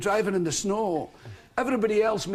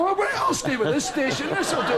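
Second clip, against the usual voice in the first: higher, laughing mock satisfaction; mock content viewer; 6 semitones higher than usual. The guest imitating a viewer who is happy with a silly forecast, higher and amused. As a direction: play the easily pleased viewer. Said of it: "I would go".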